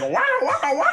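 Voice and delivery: high, loud "wah, wah, wah"; imitated high wailing noise; mock wailing. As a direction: wail it out like a siren.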